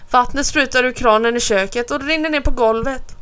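ordinary delivery, nothing marked

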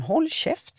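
very high, sharp yell; old lady screaming at priest; furious shout. A narrator's reading. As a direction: shout him down with fury. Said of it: "Damen hötte med fingret".

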